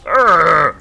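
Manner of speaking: loud orc grunt; big gruff peon grunt. Pretend. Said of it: "Like my orc voice?".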